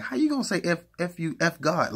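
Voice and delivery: very high, squeaky disbelief; the shocked first reaction, pitched sky high; outraged disbelief. Retold or imitated. Retold first reaction to insult.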